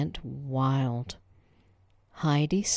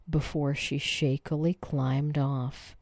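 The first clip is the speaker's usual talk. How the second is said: ordinary delivery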